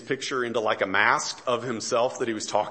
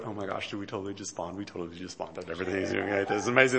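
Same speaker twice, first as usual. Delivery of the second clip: inner thrill; 5 semitones lower, much faster than usual. Retold or imitated. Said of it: "in my mind".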